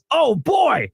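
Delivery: high, loud exclamation; loud yell. Acted. Customer to the man at the counter, loud yell. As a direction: yell it out in amazement. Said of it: "let out a loud whistle and yelled".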